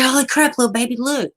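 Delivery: high-pitched excited retold outburst; excited, well up in pitch; startled exclamation. Retelling her startled reaction.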